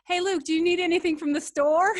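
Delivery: put-on American accent. Asking Luke about a store run in an American accent. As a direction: put on American speech, lifting at the end. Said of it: "She talks with an American accent".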